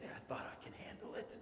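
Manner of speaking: barely voiced whisper. Brother crying at night, whispering his regret.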